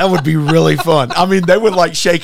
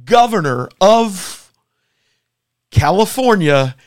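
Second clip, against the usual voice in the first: drawn-out, higher-pitched announcer build; mock event announcer; 4 semitones higher, slower than usual. Host introducing two debating governors, slow announcer style. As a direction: stretch each part of the billing for suspense.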